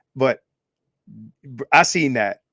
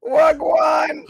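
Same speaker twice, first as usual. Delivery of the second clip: shouted Jamaican greeting; high loud patois hail; Jamaican accent; 12 semitones higher, 6 dB louder, much slower than usual. Pretend. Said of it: "that's not a Jamaican accent".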